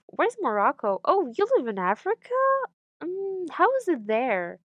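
imitating an ignorant asker, lilting up; naive questioner. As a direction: put on a clueless, wondering questioner. Acting out naive askers about Morocco.

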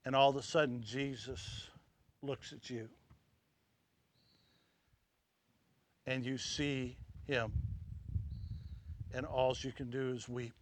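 soft, low, intimate appeal; hushed preaching. Acted. Preacher quietly putting the congregation in the scene, hushed. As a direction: bring it down to a near hush.

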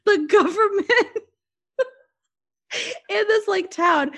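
high, laughing, half-crying words; can barely get it out for laughing; real giggles breaking through the telling. Real, not acted. Laughing at her own movie tears.

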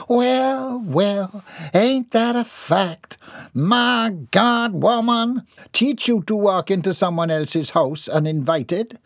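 high, slow triumphant drawl; sassy country woman. Acted. Bobby Sue gloats over John on the floor. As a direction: gloat over the fallen man.

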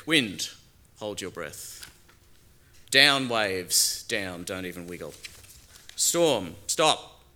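loud firm orders to the storm; commanding voice. Acted. Jesus orders the wind and waves, loud and firm. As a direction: command the sea to be still.

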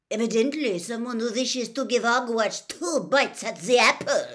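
high, loud indignant madame; loud, high-pitched indignation; grand headmistress. A narrator's reading. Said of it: "said Madame Maxime".

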